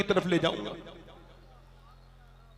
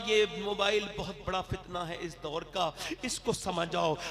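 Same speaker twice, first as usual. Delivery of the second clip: ordinary delivery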